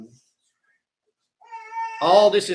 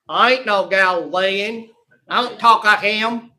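slow put-on Galilean accent, getting louder; Galilean accent denial; 2 semitones higher, 4 dB louder, slower than usual. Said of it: "we hear your accent".

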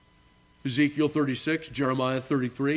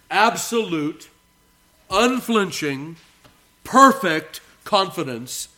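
each word slow and weighted, a little louder; slow emphatic stress; 4 dB louder, slower than usual. Acted. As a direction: lean on every word, slowly. Preacher stressing Christ's faith.

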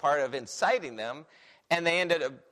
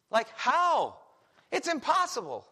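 disbelief; 8 semitones higher than usual. Acted. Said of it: "exasperated disbelief".

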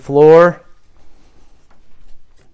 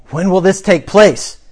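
higher, louder question in surprise; surprised question; 6 semitones higher, 5 dB louder than usual. Acted. Prisoner turns to ask the guard, surprised. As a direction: ask with startled urgency. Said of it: "You turn in surprise and you say".

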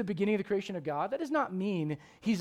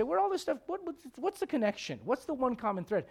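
higher, slightly put-on voice of a puzzled questioner; mock questioner; 6 semitones higher than usual. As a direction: voice the doubter asking, lightly acted. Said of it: "You say".

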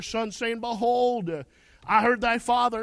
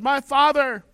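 raised, louder call to a father; 6 semitones higher, 9 dB louder than usual. A narrator's reading. Jacob addressing his father as scripture is read aloud, calling out. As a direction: voice a son calling out, higher and louder.

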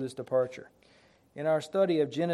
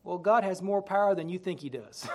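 ordinary talk, nothing marked

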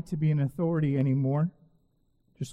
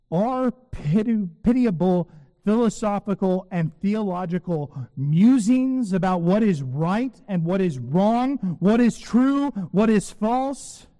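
louder and higher, driving the point home; preaching at full force; 6 semitones higher, 5 dB louder, slower than usual. Acted. Pastor warning the congregation about pitiable musings, fervent preaching. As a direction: build to a fervent, ringing climax.

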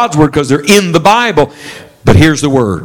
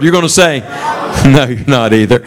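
usual speaking voice

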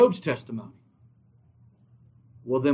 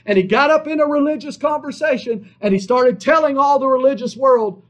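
insistent, higher-pitched sermon delivery; forceful preaching; 5 semitones higher, 4 dB louder than usual. Real, not acted. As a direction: build through each repetition, pitch riding up.

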